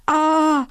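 high, drawn-out "ah" of horror; mild storybook scream, pitched high; horrified cry. A narrator's reading. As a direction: cry out in fright without much force. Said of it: "schrie Fluff entsetzt".

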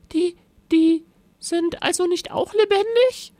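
very high, timid stammer. A narrator's reading. Frightened Rosa questioning the monster, stammering high. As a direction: falter on the first word, squeakily. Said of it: "stammelte Rosa".

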